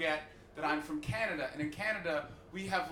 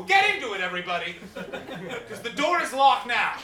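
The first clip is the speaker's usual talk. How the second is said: louder and higher, hammed-up threat; mock menacing; 6 semitones higher, 9 dB louder than usual. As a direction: pretend to lock them in with glee.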